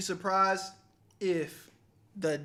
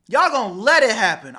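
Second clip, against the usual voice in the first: louder repeat, higher pitched; emphatic scolding; 3 semitones higher, 8 dB louder than usual. Real, not acted. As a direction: drive the point home harder.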